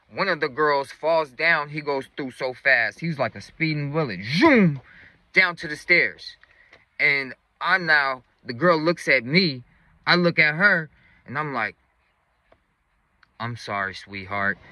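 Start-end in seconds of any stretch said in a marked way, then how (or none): 4.2-4.8 high, loud whooshing zoom; imitated zoom. Friend bolting past the girls, imitated as a high zoom. 13.4-14.6 low, soft aside; mock-tender apology. Robber to the girl blocking him, a low soft retold apology.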